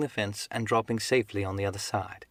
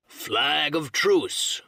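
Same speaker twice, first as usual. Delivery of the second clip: long-drawn, higher-pitched call; crying out; 5 semitones higher, 4 dB louder, much slower than usual. A narrator's reading. Silver calls to the stockade under truce, drawn-out cry. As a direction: hail them slowly across the distance. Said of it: "cried Silver".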